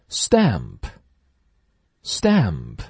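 very slow stamp, t like a weak d; slow model word. Shown as a demonstration. Teacher modelling stamp slowly for class. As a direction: stamp stretched out, no puff on t. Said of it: "当成一个弱化一点的d".

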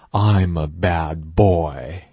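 slow, stressed model sentence; slow teacher model: I'm a bad boy; slow model reading. Shown as a demonstration. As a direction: read it slowly, leaning on bad. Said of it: "一起大声朗读".

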